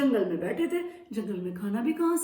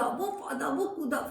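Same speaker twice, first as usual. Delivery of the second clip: ordinary delivery, nothing marked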